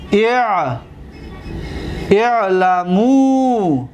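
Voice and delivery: very slow, long held final vowel; drawn-out paradigm drilling; slow dictation. Shown as a demonstration. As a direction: stretch out each syllable for the class. Teacher dictating verb forms.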